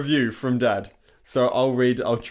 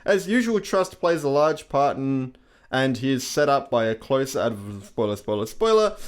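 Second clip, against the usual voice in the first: ordinary delivery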